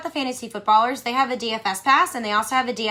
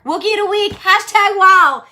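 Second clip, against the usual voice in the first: hyped; 8 semitones higher, 10 dB louder than usual. Real, not acted. Hyping the slogan.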